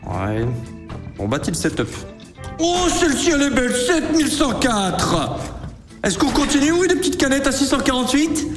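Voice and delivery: normal talking voice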